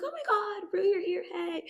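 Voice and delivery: very high, squealy mock hello; gushing greeting. Maddie, Kat and Cassie greet Rue, gushing. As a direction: imitate friends squealing at a surprise guest.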